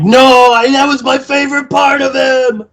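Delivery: high, hammed-up lament; mock grief. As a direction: whine it like a wounded fool. Mocking Riley's grief.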